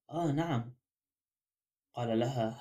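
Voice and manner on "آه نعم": understated, unimpressed acknowledgement; lukewarm tone. A narrator's reading. Girl answers the detective introducing himself, in a lukewarm tone. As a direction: acknowledge it with little interest. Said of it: "بنبرة فاترة".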